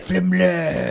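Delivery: lightly put-on French accent on Flamblade; mock French accent. Husband redoes his word for the group, mock French accent. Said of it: "tried to say flamblade in a French accent".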